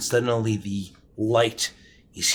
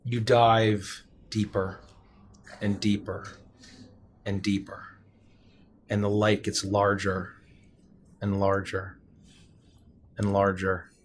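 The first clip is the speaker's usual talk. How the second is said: slow and softer, sinking; sinking narration; 3 semitones lower, 4 dB quieter than usual. A narrator's reading. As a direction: draw it down slowly.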